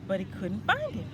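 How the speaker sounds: ordinary delivery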